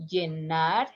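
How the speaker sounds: Llenar as "yenar"; soft y pronunciation. Shown as a demonstration. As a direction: start Llenar on a gliding y. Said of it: "How you pronounce?".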